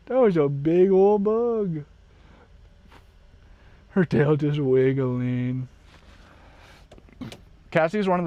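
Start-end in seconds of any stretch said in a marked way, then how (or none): 0.1-1.8 talking to dog. Real, not acted.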